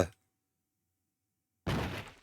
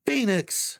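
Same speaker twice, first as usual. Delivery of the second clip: calling out high; 9 semitones higher than usual. Acted. Spirit calling Phoenix from afar.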